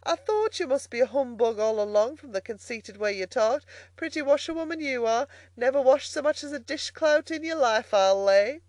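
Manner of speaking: high-pitched jeering country woman; scornfully amused barge woman. Acted. Barge woman mocks Toad.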